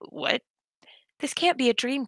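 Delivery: ordinary delivery, nothing marked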